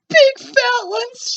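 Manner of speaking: very high, loud, slow shout; a little boy yelling in alarm. A narrator's reading. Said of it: "shouted a little boy".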